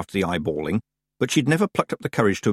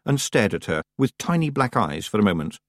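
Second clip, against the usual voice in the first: ordinary delivery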